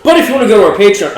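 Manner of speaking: fast, high, loud ad pitch; mock promo voice. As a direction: hype it like a sponsor plug. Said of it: "I'm kidding".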